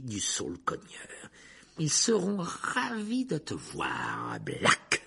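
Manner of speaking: slow taunt climbing to a high, loud mock-sweet wail; gloating, crazed villain. Teacher promises prisoner the dementors' kiss, gloating. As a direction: savour it slowly, rising into mad delight.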